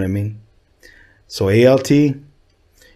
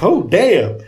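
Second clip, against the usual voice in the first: impressed; 9 semitones higher, 3 dB louder than usual. Retold or imitated. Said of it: "And I'm like".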